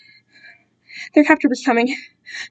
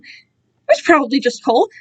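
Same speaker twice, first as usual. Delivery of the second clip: ordinary delivery, nothing marked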